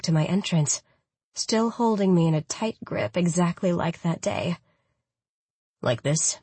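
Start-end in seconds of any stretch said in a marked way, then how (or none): 5.8-6.4 softer, lower seductive male voice; husky low lover tone; lightly voiced low lover. A narrator's reading. Khan voiced by narrator.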